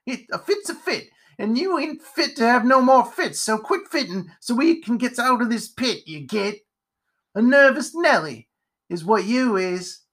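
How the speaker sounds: high, scolding character voice; crotchety ranting spider. Acted. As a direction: rant irritably at a nervous companion. Spider rants at the fit.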